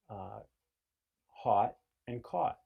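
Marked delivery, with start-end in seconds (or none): 1.3-2.6 "hot and caught" slow, higher and louder, both with "aw"; northeastern aw. Shown as a demonstration. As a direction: stretch the rounded "aw" in "hot and caught". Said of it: "you use the vowel aw and say".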